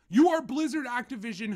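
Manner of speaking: loud, accusing call-out; ranting shout. Real, not acted. Ranting at Blizzard, a loud accusing shout. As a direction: hammer the company's name.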